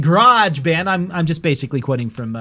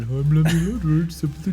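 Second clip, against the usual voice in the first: mock unenthused. Host teasing third host's intro, mock unenthused. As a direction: mimic someone not excited to be here. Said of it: "does he not sound excited to be here".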